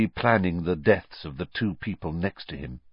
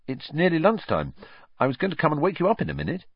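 lightly voiced Ron, brisk; 10 semitones higher, faster than usual. A narrator's reading. Ron reminding Harry it's the holidays, brisk and high. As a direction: toss it off fast like a teenage boy.

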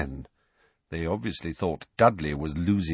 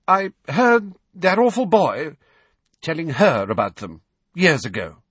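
jerky; 9 semitones higher, 6 dB louder than usual. A narrator's reading. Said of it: "she said jerkily".

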